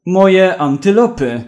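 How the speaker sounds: loud, slow wail of moje antylopy; cried out. Acted. Zoologist crying out over his lost antelopes, drawn out and loud. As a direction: shout it out in despair, drawn out. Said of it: "krzyknął".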